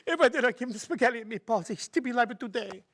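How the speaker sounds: put-on high, lamenting Italian-accented widow; hammed-up Italian widow's lament. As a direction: wail like a grieving Italian wife. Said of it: "Finally, the Italian widow spoke up".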